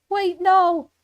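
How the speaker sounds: loud, high plea of alarm; panicked cry. Acted. Victim about to be eaten alive, alarmed shout.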